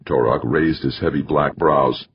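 ordinary delivery

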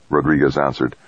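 usual speaking voice

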